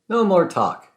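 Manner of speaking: exclaimed. Acted. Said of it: "Then Francesco exclaimed".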